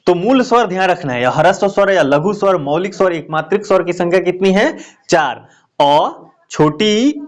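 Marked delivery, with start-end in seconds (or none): 5.8-7.2 अ, छोटी ई spaced out very slowly; slow listing. Shown as a demonstration. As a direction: count each vowel out slowly. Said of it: "slowly listing vowels". (धीरे-धीरे गिनाते हुए)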